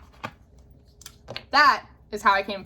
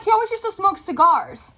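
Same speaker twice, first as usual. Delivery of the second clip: high-pitched imitation of a cousin; retold incredulous prodding; 10 semitones higher, 3 dB louder than usual. Cousin prodding narrator about Uncle Chester, high incredulous voice. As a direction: lightly mimic her surprise up high.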